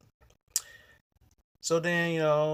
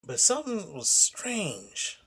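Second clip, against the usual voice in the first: slow suspicion; 3 semitones higher, much slower than usual. Real, not acted.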